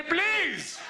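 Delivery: a fan swooning over Malik's accent, mock pleading; mock swooning plea. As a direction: swoon at him, pleading playfully.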